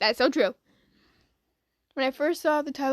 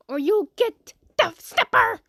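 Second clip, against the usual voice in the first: louder, slow playful threat; slow hammed-up warning; mock threat; 6 semitones higher, 5 dB louder, slower than usual.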